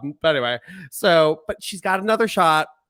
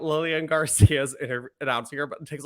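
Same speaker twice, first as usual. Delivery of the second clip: usual speaking voice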